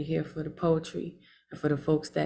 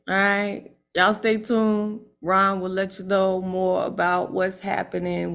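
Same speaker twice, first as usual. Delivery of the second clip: normal talking voice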